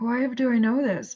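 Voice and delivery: ordinary talk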